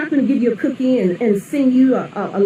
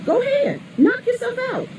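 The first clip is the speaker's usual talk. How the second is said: mock indifferent taxpayer; 11 semitones higher, 4 dB quieter than usual.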